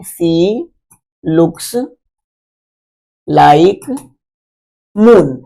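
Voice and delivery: word-by-word dictation. Shown as a demonstration. Teacher rewriting the simile for the class, word-by-word dictation. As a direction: space out each word as if writing it down. Rewriting the example in another colour.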